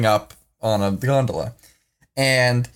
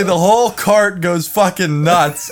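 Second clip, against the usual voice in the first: ordinary delivery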